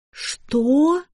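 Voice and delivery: high-pitched, incredulous yelp of a question; потрясённый возглас. A narrator's reading. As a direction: jump on the word in shock. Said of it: "подпрыгнула Шарлотта". (высокий возмущённо-изумлённый вскрик)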